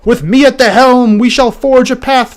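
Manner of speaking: slow, loud, high grand speech; booming warrior king. Acted. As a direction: orate like a pompous ruler. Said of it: "she just continues".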